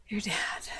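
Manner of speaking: whispered, voiceless aside; hushed reveal. Real, not acted. Critic revealing gossip about vlogger's father, whispered. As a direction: drop it to a whisper.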